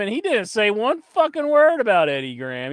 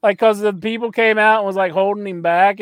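ordinary talk, nothing marked

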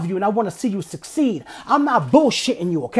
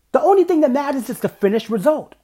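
usual speaking voice

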